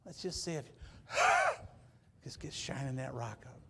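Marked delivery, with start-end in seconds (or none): 1.1-1.7 gasp. Acted. Acted shock gasp.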